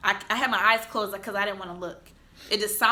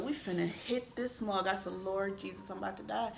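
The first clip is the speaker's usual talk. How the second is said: quiet dread; 11 dB quieter than usual. Retold or imitated. Facing the wall.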